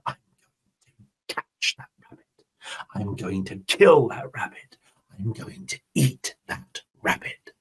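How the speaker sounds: low, soft threatening whisper; menacing whisper. Acted. Jaguar vowing to catch the rabbit, menacing whisper. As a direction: hiss the threat quietly.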